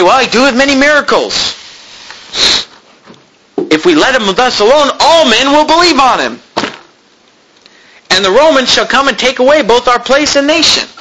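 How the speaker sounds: high, mocking complaint; mock alarm, pitched well up; fretting priests.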